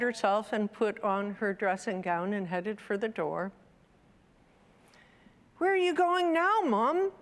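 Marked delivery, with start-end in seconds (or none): 5.6-7.1 put-on high, quick child voice; child's question. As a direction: pipe up after Mum, pitched high. Child questioning mother in story.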